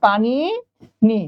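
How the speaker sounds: Panini drawn out very slowly; slow spelling demo. Shown as a demonstration. Teacher showing students how panini is spelled, very slowly drawn out. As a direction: stretch panini as you write it. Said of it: "এরকম panini".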